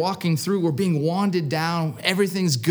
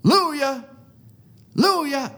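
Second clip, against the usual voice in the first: high, ringing retold holler; screamed call; 9 semitones higher, faster than usual. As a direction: imitate a pastor hollering down the cell block. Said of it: "he starts screaming".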